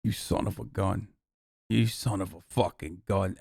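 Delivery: low, soft mock Italian American menace; Italian American accent. Streamer cursing at Tucker in a low, put-on Italian American accent. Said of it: "I'm doing an Italian American accent".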